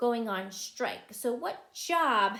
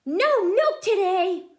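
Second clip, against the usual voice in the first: loud, higher exasperated cry; cried out; 9 semitones higher, 11 dB louder than usual. Acted. Farmer Brown finds no milk, loud upset cry. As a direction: wail it in dismay. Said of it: "cried Farmer Brown".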